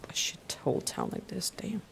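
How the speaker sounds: a hushed, voiceless aside about the town; whispered aside. Real, not acted. Player whispering a rude aside about the town, hushed. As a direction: breathe it out so nobody hears.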